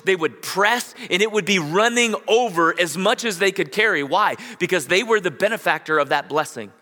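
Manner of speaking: preaching with growing force. Acted. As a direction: drive the point home to the congregation. Builds the sermon point.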